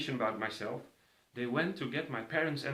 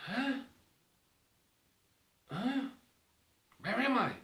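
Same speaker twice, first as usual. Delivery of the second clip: confused waking; 9 semitones higher than usual. Retold or imitated. Waking confused after accident.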